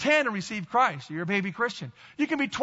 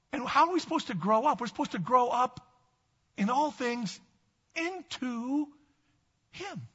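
ordinary talk

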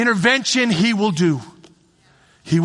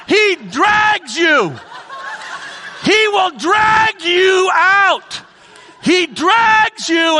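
loud and slow; 11 semitones higher, 4 dB louder, much slower than usual. Acted. Preacher acting out being dragged.

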